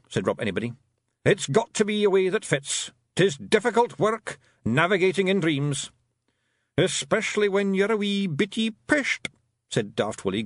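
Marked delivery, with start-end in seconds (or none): 1.2-5.9 raised gruff little Scots pixie, pleased with himself; boastful wee Feegle, a bit louder; Rob Anybody's Scots voice. A narrator's reading. Rob Anybody boasting to Tiffany after the fight, Scots pixie voice. Rob's voice. 6.8-9.3 tipsy-sounding Feegle grinning; grinning Scots pixie. A narrator's reading. Daft Willie teasing about being drunk, grinning Scots voice. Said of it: "grinning broadly".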